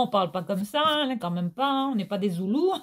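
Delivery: normal talking voice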